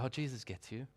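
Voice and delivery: soft, low, throwaway platitude. Acted. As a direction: toss it off quietly as a pat answer.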